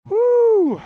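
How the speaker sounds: loud, high exasperated whoo, held out. Retold or imitated. Told to wait eight hours.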